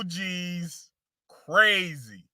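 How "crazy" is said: ordinary delivery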